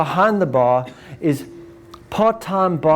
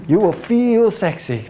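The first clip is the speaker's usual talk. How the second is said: higher, slow, seductive promise; sultry ad voice; 4 semitones higher, slower than usual. Retold or imitated. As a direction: sell it like a sexy ad. Ad voice continued.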